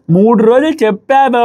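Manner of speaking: mildly imitating a forceful protest, stretched out; emphatic retold retort. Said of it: "అనేసి గట్టిగా అంటుంది". (గట్టిగా అన్నట్టు అనుకరిస్తూ, సాగదీసి)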